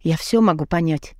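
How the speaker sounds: ordinary delivery, nothing marked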